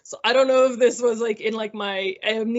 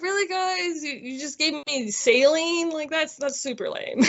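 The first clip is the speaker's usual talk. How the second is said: a much higher, mildly sarcastic whine; mock complaint; 7 semitones higher than usual. Patient teasing her medical team over the weight gain, mock whiny.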